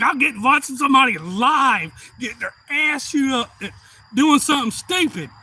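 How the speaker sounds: loud, high thrilled cheering; excited retelling. Retold or imitated. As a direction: crow it like an overjoyed spectator. Reliving thrill of watching.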